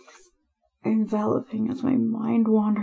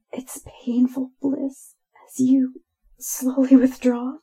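ordinary delivery

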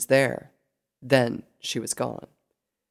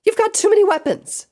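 high, loud complaint; indignant cry; 8 dB louder than usual. A narrator's reading. Pinkie protesting her foe's many blades, indignant cry. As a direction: holler it like an outraged cartoon character. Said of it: "she cried out".

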